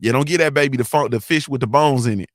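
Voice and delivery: ordinary talk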